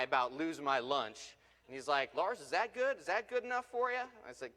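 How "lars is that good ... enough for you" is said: high, fast teasing challenge; a smug driver ribbing his shaken passenger; mock taunting. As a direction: needle him with a cocky grin. Tony taunting after the skid.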